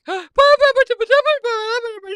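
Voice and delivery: gasp, then high loud gibberish; mock-hysterical sputter on a high pitch; frantic babbling. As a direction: babble wordlessly like someone upset and excited. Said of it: "she's all upset and excited".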